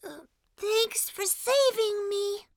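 subdued thanks. Acted. Little Mushroom thanking the one who saved him, soft and subdued. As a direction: thank them sheepishly and quietly.

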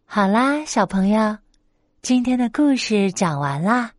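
usual speaking voice

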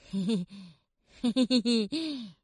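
stiff, unpractised heh-heh laugh; awkward forced giggle. Acted. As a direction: force out a clumsy chuckle. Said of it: "呃，怎么笑？". (别扭的干笑)

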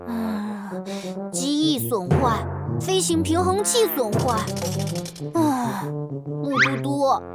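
low, slow, gloomy muttering; worried and glum. Acted. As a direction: sigh out the bad news. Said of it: "眉头都皱成了一团了".

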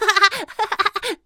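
high-pitched delighted laughter; gleeful giggle. Pretend. Young mouse enjoying space game, gleeful high laugh.

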